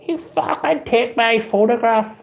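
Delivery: high, slow mock outrage; hammed-up girl's furious accusation; imitating furious girl. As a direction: squeal it up high like the angry girl.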